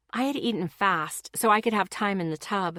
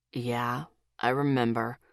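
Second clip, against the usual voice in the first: much lower and softer, guarded; careful, rougher; 7 semitones lower, 3 dB quieter than usual. A narrator's reading. Boyfriend guarded in front of his friends, low and soft. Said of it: "careful and a little rougher".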